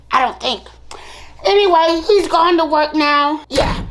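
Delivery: ordinary talk, nothing marked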